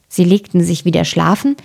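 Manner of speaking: ordinary delivery, nothing marked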